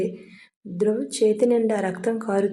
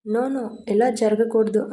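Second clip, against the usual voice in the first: ordinary delivery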